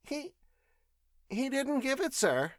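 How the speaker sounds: put-on high, halting, nervous old woman; stammering servant. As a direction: falter through the excuse. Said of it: "she stammered".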